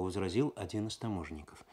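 ordinary delivery, nothing marked

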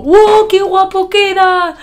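mock-awed high, loud gushing; excited past self. Vlogger mimicking her younger self proud of her editing, excited. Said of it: "cuando hice el vídeo fue como".

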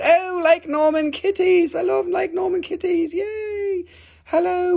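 very high cooing greeting; high sing-song pet voice. Real, not acted. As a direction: coo at the kitties like babies. Greeting viewers' kitties.

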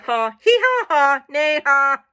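loud, punched bleat, bray and neigh laughs; laughing farm animals. Acted. Horse, donkey and sheep mocking, hammed-up laughing animal calls. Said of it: "the sheep thought this was very funny".

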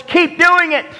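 high, sarcastic jeer; mocking taunt. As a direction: egg them on in a high mocking voice. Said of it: "he is mocking them".

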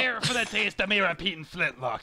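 lightly voiced pirate, farmer-ish West Country. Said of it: "He's a pirate!".

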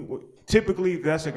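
normal talking voice

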